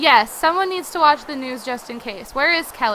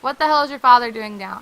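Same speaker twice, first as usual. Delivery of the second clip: usual speaking voice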